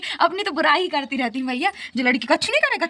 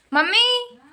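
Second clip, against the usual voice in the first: high, louder long call; 10 semitones higher, 5 dB louder, much slower than usual. Real, not acted. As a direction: stretch mummy out to reach across the house. Calling out to her mother. (ज़ोर से mummy को पुकारना)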